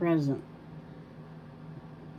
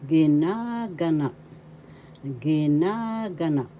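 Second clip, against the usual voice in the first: ginaganap, loud, with NA stretched out; stress on second syllable; 4 dB louder than usual. Shown as a demonstration. Teacher modelling for learners, ginaganap with a long stressed NA. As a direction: hold and push the NA in ginaganap. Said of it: "The second".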